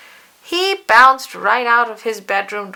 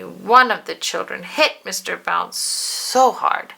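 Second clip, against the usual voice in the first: plain reading voice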